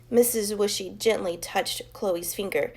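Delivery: usual speaking voice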